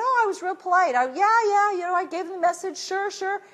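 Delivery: high, sheepish imitation of himself; mock meek self. Mocking his own meek reply.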